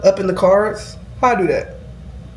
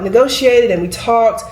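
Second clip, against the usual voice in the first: usual speaking voice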